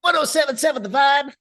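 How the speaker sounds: high, loud hyped announcer yell; radio announcer shout. Shown as a demonstration. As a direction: blast the station ID like a DJ. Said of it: "No, actually, hold on".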